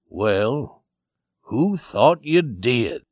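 slow, unhurried character voice; the cowboy. Acted. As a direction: take your time with the question. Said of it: "stretched his long legs slowly and carefully".

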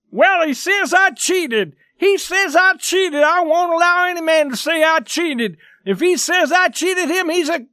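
high, loud, outraged protest; indignant crying out. A narrator's reading. Accused player struggling to break free, indignant crying out. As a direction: protest hotly at a high-pitched yell. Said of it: "was crying".

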